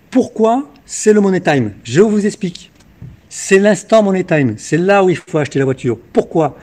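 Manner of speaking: loud, punchy sales pitch; infomercial. Acted. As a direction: hype it up like a TV car dealer. Car dealer hard-sell pitch.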